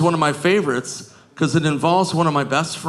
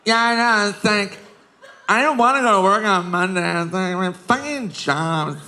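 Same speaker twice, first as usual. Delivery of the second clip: put-on drunk girl at bar; 4 semitones higher than usual. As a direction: whine like a tipsy girl at the bar. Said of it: "hammered at the bar like".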